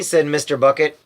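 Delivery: plain reading voice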